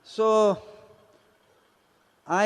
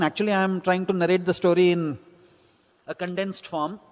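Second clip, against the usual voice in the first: usual speaking voice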